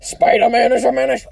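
hammed-up outraged impression; J. Jonah Jameson. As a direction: bark it like a blustering cartoon boss. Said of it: "a Jameson impression".

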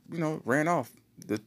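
usual speaking voice

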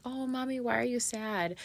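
imitated small child, high and soft; Layla. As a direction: mimic a concerned little daughter, softly. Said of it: "she was like".

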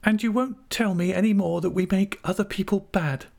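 put-on high, girlish wheedling; coaxing. A narrator's reading. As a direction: coax him in a child-wife's high voice. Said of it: "coaxed Dora".

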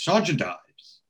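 high, airy call to the sergeant; a hint of a posh lady calling someone over; lightly voiced posh lady. Nosy posh lady calls over the village sergeant, light high voice. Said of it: "she calls over Sergeant Ives".

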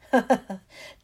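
loud burst of jeering laughter; mocking laugh. Malfoy jeers at Neville with a loud burst of laughter. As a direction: laugh at him cruelly. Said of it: "Malfoy burst into laughter".

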